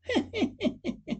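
high, spooky cackle after a gag; eerie cackle. Acted. Ghoulish host to his audience, high eerie cackle.

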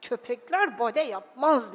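grumbled in a put-on father's voice; grumbling. Father grumbling at the dog on stage, grumpy. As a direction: grumble it under your annoyance. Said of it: "Babam homurdanarak".

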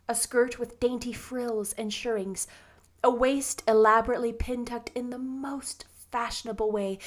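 usual speaking voice

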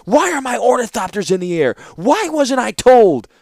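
high, loud, indignant yelling; outraged roar. A narrator's reading. Artificer confronting the warlord over his machines in the air, roaring. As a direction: demand answers at full voice. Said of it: "the artificer roared".

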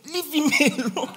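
high, laughing mock call; mock shout. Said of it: "They were shouting".

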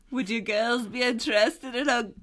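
a mild attempt at a leering Australian biker; Australian accent. Host imitating the biker offering the girls, lightly Australian. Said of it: "his hot Australian accent".

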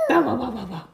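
loud big-dog bark; loud bow-wow dog. Acted. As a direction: bark it out like a hound.

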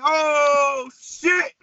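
high, loud, drawn-out cry; retold yelp of alarm while running out; mock panicked holler; alarmed outburst. Retelling a panicked club escape.

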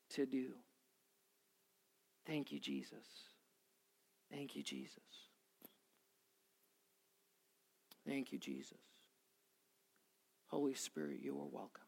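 very soft, private thanks to God; hushed prayer. Real, not acted. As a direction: let the prayer sink low, long pauses between.

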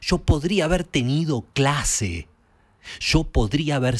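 acted outburst of frustration; furious shout. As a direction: explode at the brother. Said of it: "Terry estalla de verdad y le grita".

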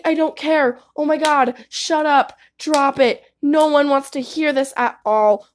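usual speaking voice

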